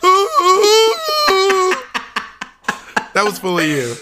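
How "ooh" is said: loud, very high long "ooh"; hammed-up overwhelmed fan howl; imitated fan wail. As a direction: wail it like a starstruck concertgoer. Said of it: "she goes".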